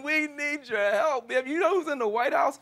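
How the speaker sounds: hammed-up shout of protest; mock outrage. Host playfully scolds departing guest.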